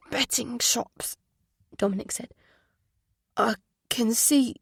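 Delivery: thick confused voice. Acted. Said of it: "His voice was thick and confused".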